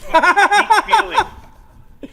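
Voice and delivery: high, loud, slow laughing wail of mock emotion. Caller hamming up emotion.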